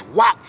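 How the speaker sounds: loud imitated thwack; whop sound effect. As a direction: mimic the swing. Second horseshoe slung.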